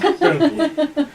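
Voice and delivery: laughing. Real, not acted. Laughs after voice cracked.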